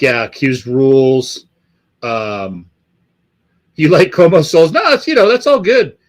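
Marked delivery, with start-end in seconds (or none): 3.8-6.0 high, loud mocking whine of a fan; hammed-up sneering fan voice. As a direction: parody the fan who likes it. Mocking a fan's taste in high voice.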